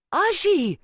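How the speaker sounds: a sudden, very high and loud scandalised yelp; shocked outcry. A narrator's reading. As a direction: granddaughter scandalised by grandmother's boyfriend, shocked yelp. Granddaughter shocked by boyfriend question.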